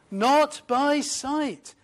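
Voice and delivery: a slow, high "Not by sight" driven home; stress on not by sight. Shown as a demonstration. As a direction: press down on "Not", then draw out "by sight". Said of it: "let's emphasize that".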